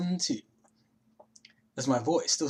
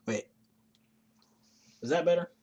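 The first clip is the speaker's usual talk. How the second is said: normal talking voice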